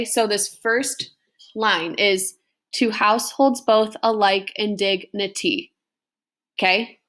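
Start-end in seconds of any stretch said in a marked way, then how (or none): 2.8-5.7 "To households both alike in dignity" with every second syllable stressed; stressed iambic reading. Shown as a demonstration. Teacher reads the chorus to students, purposefully marking the unstressed-stressed beat. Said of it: "I kind of read it purposefully".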